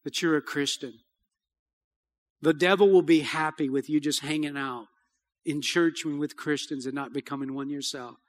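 soft, hushed invitation to repent; quiet altar call. Acted. Pastor inviting the congregation to repent, softly. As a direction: speak gently to bowed heads.

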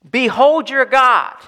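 ringing call to behold; proclaiming. Shown as a demonstration. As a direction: announce it to the whole church. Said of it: "what are we to say".

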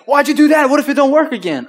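very high, loud incredulous questioning; hammed-up alarmed scolding; alarmed disbelief. Dad questioning son's risky move, alarmed.

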